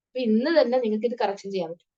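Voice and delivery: normal talking voice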